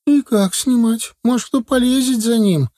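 high-pitched anxious country woman; village woman asking. Acted. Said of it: "спросила полненькая женщина". (женщина спрашивает высоко, встревоженно)

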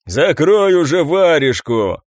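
heckling shout. A narrator's reading. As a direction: shout at him to shut up. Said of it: "кричали из камер". (раздражённый выкрик из камеры)

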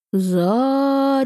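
slow, savoring the name; drawn out. A narrator's reading. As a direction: stretch it out, tasting it. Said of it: "протянул, словно изучая и пробуя на вкус".

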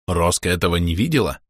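plain reading voice

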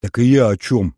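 heated exhale. A narrator's reading. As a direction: blurt it out hotly. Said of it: "горячо выдохнула Света". (горячо, на выдохе)

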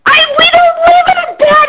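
high furious shout. Real, not acted. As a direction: scream the denial. Customer shouts denial.

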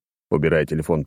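plain reading voice

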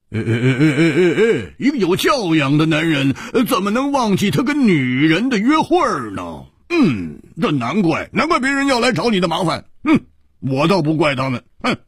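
high, animated voice opening on repeated "ai"; 蝴蝶花 chiding. Acted. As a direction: tut and lecture in a high, exaggerated voice. 蝴蝶花摇着头说，角色腔. (高亢夸张地数落，带哎哎哼哼声)

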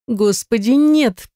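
high-pitched, pleading moan; moaning, near tears. A narrator's reading. Heroine begs her lover, moaning near tears. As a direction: plead high, on the edge of crying. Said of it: "простонала, чуть не плача".

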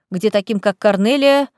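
plain reading voice

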